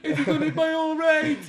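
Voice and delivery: high, slow, worried question. Retold or imitated. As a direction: ask it in a squeaky worry. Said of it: "When he's like".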